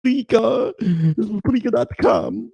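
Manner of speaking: high, slow drawn-out Spreaker; stretching out Spreaker dot com like an announcer; mock announcer. Host plugging his podcast site in a high, slow voice.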